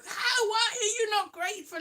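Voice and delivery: high indignant mum, imitated; mum's indignation. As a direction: play mum, affronted and high. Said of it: "She's like".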